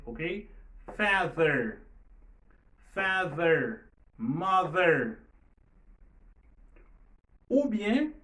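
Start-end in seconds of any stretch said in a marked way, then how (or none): 1.0-5.2 "Father", "mother" loud, with a voiced th. Shown as a demonstration. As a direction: drill "father" and "mother" loudly, buzzing the th. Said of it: "li sonore". ("father", "mother" byen fò, th sonò)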